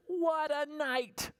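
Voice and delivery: high, slow, muttering; fussy little mole. Acted. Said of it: "Mole mutters".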